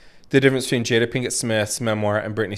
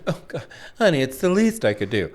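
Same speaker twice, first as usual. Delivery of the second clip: mock patronizing; 5 semitones higher than usual. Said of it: "and then to be like".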